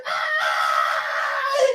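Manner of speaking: long held mock scream; imitated scream. Retelling the woman's reaction to the blood, imitated scream. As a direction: let out one sustained horrified "ah". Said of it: "she's, like, just screaming".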